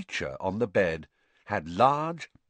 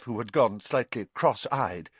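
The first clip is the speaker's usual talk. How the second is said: usual speaking voice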